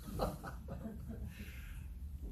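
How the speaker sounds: breathy chuckle; quiet wheezing laugh. Real, not acted. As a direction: chuckle to yourself. Laughs after drink-it story.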